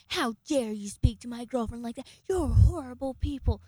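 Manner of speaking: loud, indignant mock tirade; imitating angry roommate. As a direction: throw the words at her like a retold outburst. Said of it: "and just starts flipping out on me".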